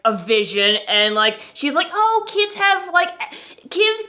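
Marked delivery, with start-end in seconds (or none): 1.9-4.1 dismissive doctor. Retold or imitated.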